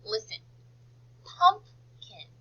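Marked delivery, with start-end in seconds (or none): 1.2-2.3 PUMPKIN high, split PUMP... KIN; slow PUMPKIN with a gap before KIN; syllable by syllable. Shown as a demonstration. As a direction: break PUMPKIN in two for careful listening. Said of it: "there are two syllables".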